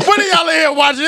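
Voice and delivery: high, loud, scolding granny; grandma voice. Retold or imitated. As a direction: shout it like a nosy grandmother. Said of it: "She's like".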